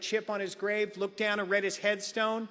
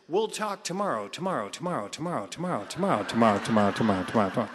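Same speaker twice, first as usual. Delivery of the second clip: tomorrow repeated sinking much lower and lower; echoing fade; 10 semitones lower, faster than usual. Retold or imitated. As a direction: let the word tomorrow echo away, deeper each time. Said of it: "he says".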